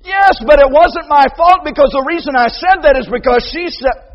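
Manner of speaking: high, loud shouted excuse; protesting shout. Retold or imitated. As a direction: shout back a defence. Child blaming excuse.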